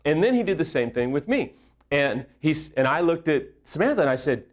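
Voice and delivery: ordinary talk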